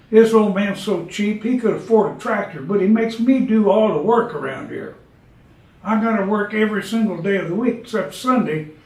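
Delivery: put-on an overworked old horse airing grievances; talking horse.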